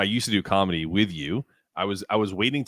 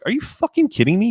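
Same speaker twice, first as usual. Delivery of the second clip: much higher and louder, reliving the shock; retold disbelief; 14 semitones higher, 5 dB louder than usual. Telling his cohost how he reacted to the news, in disbelief. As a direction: blurt it out in disbelief. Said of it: "And I was like".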